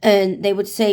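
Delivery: ordinary talk, nothing marked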